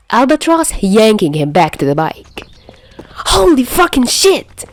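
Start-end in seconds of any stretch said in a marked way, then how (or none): 3.4-4.7 high, loud alarmed yell. Acted. Albatross yelling, alarmed, as the bombs roll in. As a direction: shout it as the danger hits. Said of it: "he yells".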